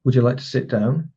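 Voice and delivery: "sit down" kept on the level; even tune right through to "down"; level statement. Shown as a demonstration. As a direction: say it as a statement, no lift on "down". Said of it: "If it stays on the level".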